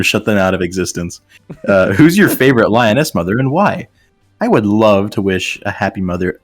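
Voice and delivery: ordinary talk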